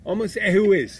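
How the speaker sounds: high, loud comeback; indignant challenge. Real, not acted. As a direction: throw the tease right back. Challenges listener's teasing "Almost.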